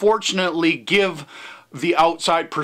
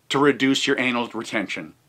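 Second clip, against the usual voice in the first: ordinary delivery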